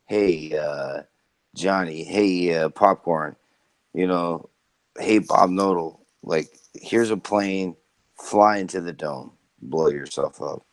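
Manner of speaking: low, lightly voiced dictator giving orders; Hitler voice. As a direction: drop the voice low, a mock tyrant handing out a suicide mission. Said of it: "Hitler's still alive and he's like".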